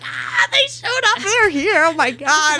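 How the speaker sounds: high, squealing mock scream; shrieky fangirl freak-out, played up; retold excited scream. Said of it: "I literally started screaming".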